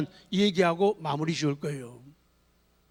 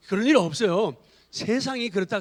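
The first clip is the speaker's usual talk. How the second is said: ordinary delivery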